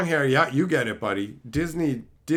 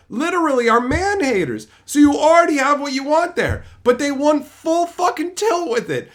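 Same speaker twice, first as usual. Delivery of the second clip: rising rant; 11 semitones higher, 5 dB louder than usual. Real, not acted. Ranting about the show.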